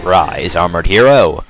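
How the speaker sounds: ordinary talk, nothing marked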